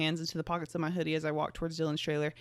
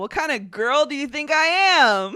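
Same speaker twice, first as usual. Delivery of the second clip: mock-offended, raised and loud; 8 semitones higher, 10 dB louder than usual. Real, not acted. Reader mock offended at the story's line. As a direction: play the scandalized reader.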